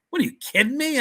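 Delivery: can't believe what he's hearing; incredulous. Retold or imitated. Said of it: "I go".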